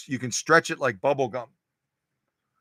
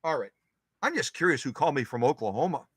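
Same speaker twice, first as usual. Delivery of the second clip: ordinary delivery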